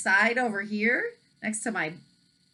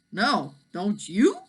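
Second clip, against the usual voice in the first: dragon voice; 2 semitones higher, 4 dB louder, slower than usual. A narrator's reading. Dragon answers.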